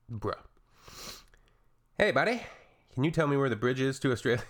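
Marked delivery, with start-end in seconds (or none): 2.0-4.4 mock clueless tourist. Mocking a tourist's question.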